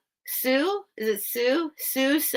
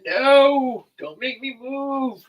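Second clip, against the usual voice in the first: put-on higher voice for a reluctant dog; Bucky; 4 semitones higher, 5 dB louder, slower than usual. Host speaking for the dog who won't budge, mock protest. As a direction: voice the pet's protest, drawn out and raised. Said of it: "He's like".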